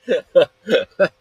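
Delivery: loud, high burst of laughter; real laugh. Real, not acted. Storyteller amused by own retold comeback, loud laugh. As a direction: crack up loudly.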